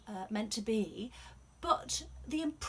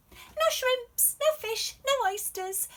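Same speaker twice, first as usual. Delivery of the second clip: high, loud teasing reply; cheeky girl; 11 dB louder than usual. Acted. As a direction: fire it back with a grin. Shen answering cheekily.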